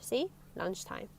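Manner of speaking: usual speaking voice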